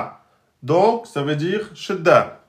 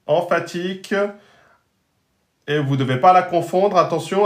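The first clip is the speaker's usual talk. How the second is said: ordinary delivery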